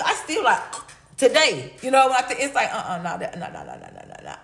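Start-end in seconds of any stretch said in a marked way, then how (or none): 2.7-4.4 fading refusal. Real, not acted. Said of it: "you can't sell me on nothing".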